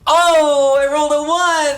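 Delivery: high loud groan of dismay; dismayed exclamation. Real, not acted. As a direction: react to the bad roll. Bad dice roll.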